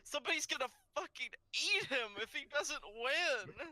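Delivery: high, incredulous laughing voice; laughing outburst. Real, not acted. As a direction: protest through real laughter. Laughing over absurd threat.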